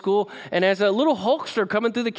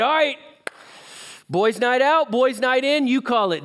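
high, bouncy offer; jolly him along, pitched up and punchy; upbeat rallying; 6 semitones higher than usual. Retold or imitated. Son alone with his sick dad, rallying him cheerfully.

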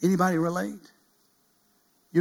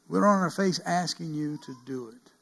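ordinary delivery

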